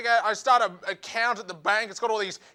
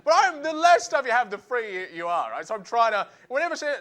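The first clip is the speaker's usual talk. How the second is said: ordinary talk, nothing marked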